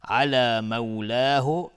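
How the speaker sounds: slow, melodic, drawn-out chant; Quran recitation. Shown as a demonstration. Reciter chanting the whole verse slowly, melodic and devout. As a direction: let each phrase ring out, slow and devout.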